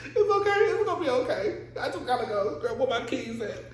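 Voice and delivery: hammed-up panic hunting for keys; mock frantic self-calming. Herself rushing to the hospital, high laughing panic. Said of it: "that's me".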